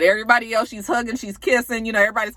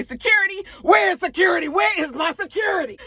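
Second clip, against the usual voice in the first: higher, half-shouted hostess calling out; mock call for security; 9 semitones higher than usual. Kenya at her party yelling for security, mock shout. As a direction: call out across the room, demanding security.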